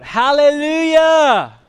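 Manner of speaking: long, high, full-voiced shout of praise; crying out. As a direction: cry it out like a great multitude. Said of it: "crying out".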